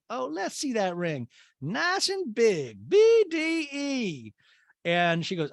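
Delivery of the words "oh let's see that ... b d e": high, very slow savoring impression; mock society matron. Mother admiring Madison's engagement ring, high drawn-out relish. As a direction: stretch each word in hammed-up falsetto.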